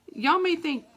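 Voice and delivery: ordinary talk, nothing marked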